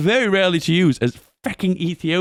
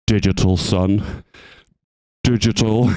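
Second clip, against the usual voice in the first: parent voice; 5 semitones lower, 3 dB louder than usual. Retold or imitated. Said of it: "And they go".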